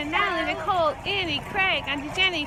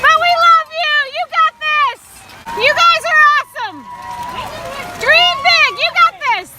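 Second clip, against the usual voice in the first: cheering; 7 semitones higher than usual. Real, not acted. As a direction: yell encouragement to runners. Cheering runners passing by.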